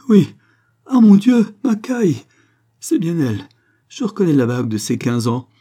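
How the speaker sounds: crescendo wail. Acted. Mother recognising her dead daughter, anguished rising wail. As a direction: howl it like a dog in distress. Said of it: "une plainte allant crescendo comme un cri de chien en détresse".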